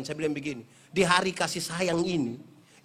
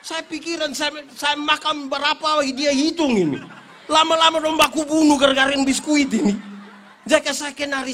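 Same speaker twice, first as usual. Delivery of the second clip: laughing exaggeration; 7 semitones higher, 9 dB louder than usual. Real, not acted. Preacher joking that children count his biscuits, loud laughing. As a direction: laugh through the punchline. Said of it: "Dia cek saya satu-satu ini".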